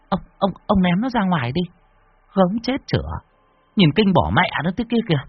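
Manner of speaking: high-pitched wife, disgusted at the bug; a fussy wife nagging her husband; wife's voice. Acted. (giọng bà vợ cao, ghê tởm)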